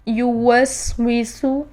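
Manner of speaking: یو وس وی سو, syllable by syllable; drill demo. Shown as a demonstration. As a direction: model each word for students. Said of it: "اور آگے دیکھیے".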